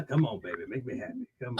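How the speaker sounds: low hushed seductive voice; sultry whisper. Pretend. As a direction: coax softly and low. Repeats the sultry plea.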